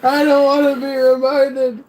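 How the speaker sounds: high, loud, laughing distress; anguished wail. Real, not acted. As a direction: cry out in mock agony. Disgusted wailing at reminder.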